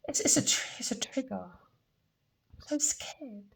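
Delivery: shaky near-whisper as tears come; fighting back tears, voice breaking to a whisper; real fear catching in the throat; on the verge of tears. Real, not acted. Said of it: "I'm gonna start crying".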